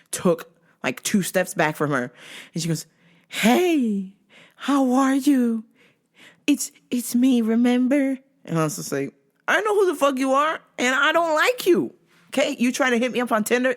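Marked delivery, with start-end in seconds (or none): 3.3-8.2 higher, drawn-out put-on greeting; creepy stalker. Stalker reminding the worker who she is, creepy coo. 9.5-13.7 high, indignant snap; exasperated retort. Retold or imitated. Worker telling off the stalker, exasperated.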